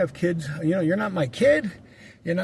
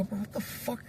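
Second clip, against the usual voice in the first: much softer, tense rebuke under the breath; hushed rebuke; 4 semitones higher, 11 dB quieter than usual. Retold or imitated. Scolding his friend while walking past the girl, hushed. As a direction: scold him so the girl can't hear.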